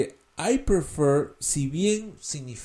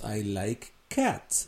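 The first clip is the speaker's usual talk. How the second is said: slow teaching demo; 5 semitones lower, 8 dB quieter, much slower than usual. Shown as a demonstration. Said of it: "Si te gustan los gatos".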